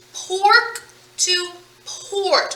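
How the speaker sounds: "Pork to port" said very slowly with the end sounds drawn out; slow demo read. Shown as a demonstration. Teacher stretching pork to port so students hear the ending. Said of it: "What change am I making?".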